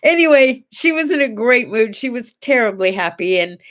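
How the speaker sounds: ordinary talk, nothing marked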